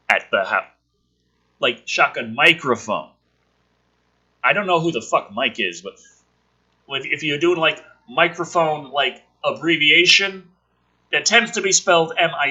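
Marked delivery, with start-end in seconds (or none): none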